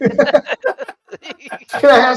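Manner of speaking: put-on high, slow little kid asking; childlike voice. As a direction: beg sweetly like a small child. Mimicking asking auntie for bacon.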